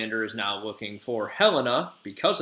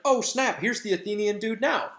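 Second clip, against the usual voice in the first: much higher "Oh, snap" in sudden surprise; mock surprise; 10 semitones higher than usual. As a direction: spot him and point, high and eager. Oberon spots the Athenian.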